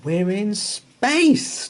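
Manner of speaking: high, slow, drawn-out cry; excited yell. A narrator's reading. Danny shouting to Dino in the rocket, high and slow. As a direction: marvel at floating in space. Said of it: "Danny yelled".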